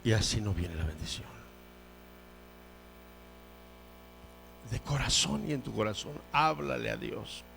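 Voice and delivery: low, soft and solemn; quiet pastoral urging; hushed appeal. Real, not acted. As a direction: bring it right down after the outcry. Preacher quiet after climax.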